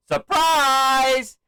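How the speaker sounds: high, loud, drawn-out shout; big showman's holler, held long; imitated ring announcement. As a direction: yell it to a whole arena. Quotes Cena announcing on the mic.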